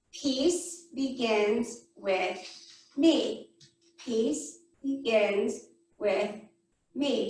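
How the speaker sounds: slow, low mantra, a gap between words; meditation mantra. Shown as a demonstration. Teacher showing kids how to tap each finger, slow mantra. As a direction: say it slowly, one word per finger tap. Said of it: "Goes like this".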